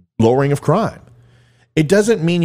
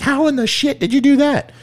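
high, squeaky mock-wife voice, much higher; wife's shocked voice; 12 semitones higher than usual. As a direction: play the stunned wife in a shrill falsetto. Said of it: "She's like".

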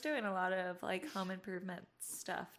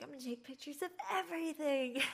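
quick, higher and eager; a touch of giddy teen; eager tourist; 7 semitones higher, faster than usual. Retold or imitated. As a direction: rattle it off like an excited kid with a camera. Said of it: "I was like".

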